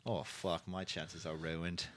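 put-on low, hushed, slow Scottish accent; despairing Scot muttering low, badly imitated. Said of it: "very nice Scottish accent you got there".

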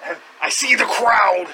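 loud wrestling promo shout; Hulk Hogan promo. Retold or imitated. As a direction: bellow it like a pro wrestler on the mic. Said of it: "if Hogan cut a promo".

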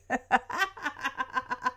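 soft mock laugh in a string of ha-has; imitated group laugh. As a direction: imitate everyone laughing at someone. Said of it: "everyone laughs at Max".

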